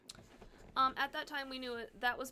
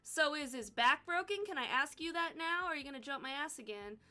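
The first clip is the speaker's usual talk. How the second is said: loud, higher, sarcastic challenge; pointed loud challenge; 4 semitones higher, 7 dB louder than usual. Retold or imitated. To the doctor who cut her off, a loud sarcastic challenge. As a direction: push back hard on the doctor.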